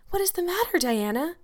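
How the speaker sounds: put-on a girl's anxious high-pitched question; alarmed cry. A narrator's reading. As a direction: ask it in urgent concern. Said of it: "cried Anne".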